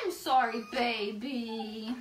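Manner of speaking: drawn-out pretend sorry to baby; teasing, exaggerated sorry; mock apology. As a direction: playfully apologise to a baby, stretching it.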